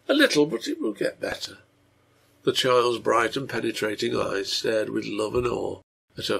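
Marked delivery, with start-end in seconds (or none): 0.1-1.6 put-on high-pitched child voice; sick little girl. A narrator's reading. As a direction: reassure father in a small high voice.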